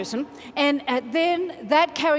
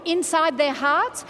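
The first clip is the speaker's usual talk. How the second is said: usual speaking voice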